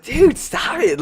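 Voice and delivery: high, pleading protest; pleading kid. Retold or imitated. Son begging his mom to quit the prank, high pleading. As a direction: beg her to stop it. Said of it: "I'm just like".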